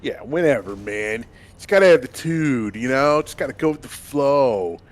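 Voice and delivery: loud laid-back surfer dude, uptalk. Acted. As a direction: chill surfer bro, rising on you know. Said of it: "the surfer voice?".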